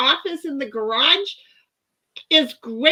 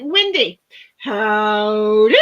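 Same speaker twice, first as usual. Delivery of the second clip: cowboy howdy; 3 semitones lower, 7 dB louder, much slower than usual. Retold or imitated. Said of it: "Tammy's like".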